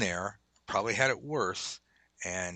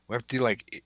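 ordinary talk

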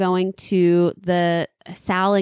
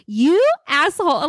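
playfully offended, high insult; hurt and indignant, half-joking; mock outrage; 7 semitones higher, 3 dB louder than usual. Retelling her dream, snapping at Sal over the earrings in mock anger. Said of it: "was so upset".

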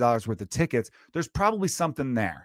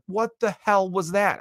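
much higher, slow, drawn-out exclaim; startled dad outburst; 8 semitones higher, slower than usual. Retold or imitated. Dad shocked as his son's vomit splashes the floor, slow disgusted outburst. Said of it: "I literally said it like that".

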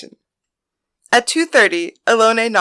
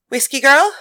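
calling through a door; 6 semitones higher, 7 dB louder than usual. Acted. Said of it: "Mary announced".

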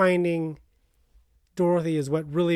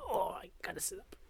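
soft whisper to herself; whispered aside; 15 dB quieter than usual. Real, not acted. Co-host adjusting in her seat, a whispered aside. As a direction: mutter it under your breath while moving.

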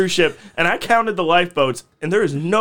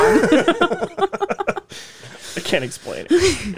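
laughing; 7 semitones higher, much slower than usual. Real, not acted. Laughing confession about couch.

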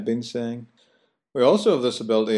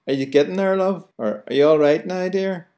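high, louder sing-song counter voice; Belfast shop counter; 8 semitones higher, 3 dB louder than usual. Retold or imitated. Host imitating counter staff, high sing-song Belfast voice. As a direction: sing it up high on love and dear. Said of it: "The person behind the counter will say".

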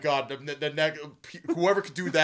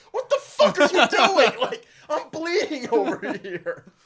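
high, slow, laughing outrage; outraged kid; 9 semitones higher, 8 dB louder, slower than usual. Retold or imitated. Bleeding kid protesting to Richie, outraged yell. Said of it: "the kid like".

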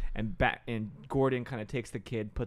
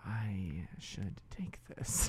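sneaky quiet; 5 semitones lower, 9 dB quieter, much slower than usual. Retold or imitated. Sneaky teen retold.

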